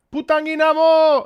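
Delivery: high, loud, excited cheer. Real, not acted. Streamer greeting viewers with a mistaken phrase, excitedly. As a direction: shout it out with real excitement. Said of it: "The way I said it excitedly".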